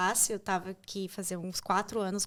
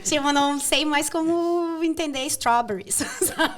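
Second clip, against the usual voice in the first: laughing; 4 semitones higher, 5 dB louder than usual. Real, not acted. Laughing at fruit stall confusion.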